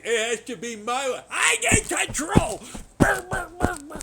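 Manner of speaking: hammed-up megalomaniac cry then brr noises; mock tyrant shout. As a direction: bellow it like a power-mad villain.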